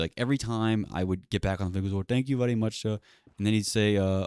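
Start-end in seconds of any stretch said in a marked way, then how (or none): 1.9-3.0 put-on higher-pitched Indian-accented thanks. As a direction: imitate a profusely polite agent with an Indian accent. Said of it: "racist Indian accent".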